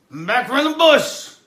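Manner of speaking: loud, high proud announcement; triumphant shout. Acted. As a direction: bellow the return. Character's loud entrance.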